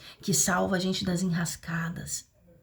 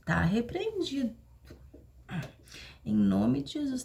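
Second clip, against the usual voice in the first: high, sing-song voice of someone full of false humility; sarcastic high-pitched impersonation of a self-righteous churchgoer; mock humble believer; 5 semitones higher than usual. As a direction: parody the proud person who claims to be humble. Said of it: "Aí vocês dizem assim".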